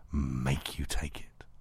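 fading into a soft whisper; taunting whispered aside. Acted. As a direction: drop the voice to a whisper.

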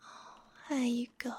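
ordinary talk

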